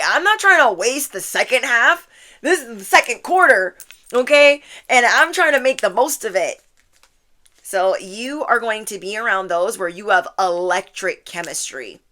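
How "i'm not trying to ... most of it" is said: loud, higher, determined declaration; emphatic resolve. Acted. Reader voices viewer vowing not to waste second half, emphatic. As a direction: lay it down loudly and firmly.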